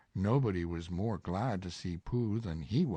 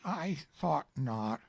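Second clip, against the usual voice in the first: high, slow bear voice; Pooh, resigned; 7 semitones higher than usual. Acted. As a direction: nod it to yourself, slowly.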